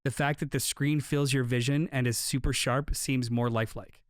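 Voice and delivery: usual speaking voice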